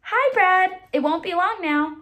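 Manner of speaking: bright greeting to her husband; Katie's voice. Acted.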